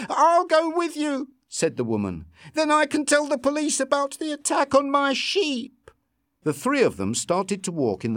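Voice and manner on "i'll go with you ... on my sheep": high, earnest woman's voice; a worried farmer, eager to help. A narrator's reading. Farm woman offering to come to the police, earnest.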